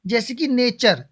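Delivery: normal talking voice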